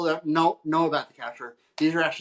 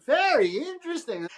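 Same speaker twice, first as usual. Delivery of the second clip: mock intrigue; 13 semitones higher than usual. Bride teases the photographer, pitching "very interesting" high. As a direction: go way up on "very interesting", playfully amazed. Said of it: "she's like".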